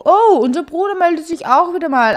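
oh-oh, the brother's calling again; excited. Real, not acted. Brother calls in the game. (aufgeregt, höher und lauter)

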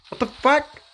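high loud disbelieving cry; shocked outburst. Real, not acted. Streamer sees the tower fight go wrong, shocked. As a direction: caught off guard.

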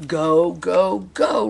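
loud chant rising in pitch; storyteller's excited crowd chant; roaring crowd. A narrator's reading. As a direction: roar it like a cheering race crowd. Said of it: "roared the crowd".

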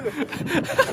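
short squeaky high laugh. Real, not acted. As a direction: burst out at the silly dance. Laughing at the dance.

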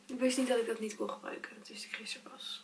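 hushed confiding whisper. Real, not acted. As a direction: whisper it like a secret. Confiding to camera.

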